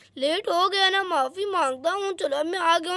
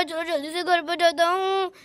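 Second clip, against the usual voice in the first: usual speaking voice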